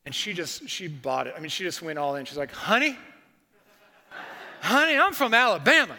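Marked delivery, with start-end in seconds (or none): 2.5-6.0 put-on higher, louder Southern accent with a laugh. Target clerk telling the pastor about Alabama, Southern accent. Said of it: "This is my Southern accent".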